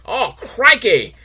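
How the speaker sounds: a hint of the crocodile hunter's bedroom roleplay; mock Steve Irwin. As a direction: stretch out the crikey like the crocodile hunter. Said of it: "Is like".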